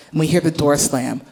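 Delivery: ordinary talk, nothing marked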